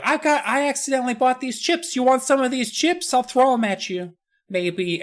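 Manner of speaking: put-on high, airy popular-girl voice; ditzy girl. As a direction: offer chips like a clueless popular girl. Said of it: "says something like".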